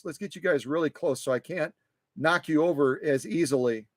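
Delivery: usual speaking voice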